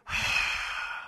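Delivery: long, soft exhale; airy sigh with no voice; demonstrated breath puff. Shown as a demonstration. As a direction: breathe out as if fogging cold air. Demonstrates breath vanishing on cold morning.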